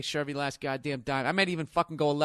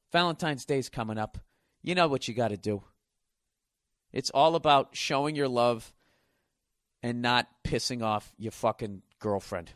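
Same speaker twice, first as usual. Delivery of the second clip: ordinary delivery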